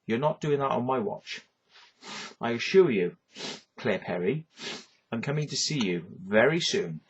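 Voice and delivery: usual speaking voice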